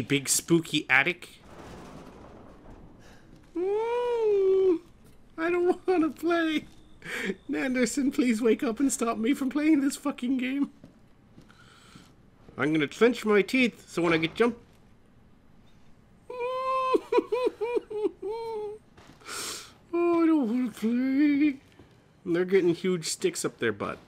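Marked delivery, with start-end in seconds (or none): 3.6-10.7 put-on high, whimpering scared-kid voice; hammed-up frightened whimper, much higher; mock scared whine. Pretending to be too scared. 16.3-18.8 high-pitched jittery giggle; mock frightened titter; high nervous giggle. Nervous laugh in scared voice. 19.9-21.6 put-on high, whimpering scared-kid voice; hammed-up frightened whimper, much higher; mock scared whine. Pretending to be too scared.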